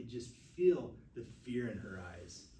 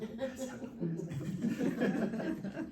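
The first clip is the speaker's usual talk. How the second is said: high, laughing self-chant; laughing mantra; 12 semitones higher, 5 dB louder, much slower than usual. Shown as a demonstration. Nurse laughing out her inner mantra to class. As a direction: chant it to yourself through a laugh. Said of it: "something you say to yourself".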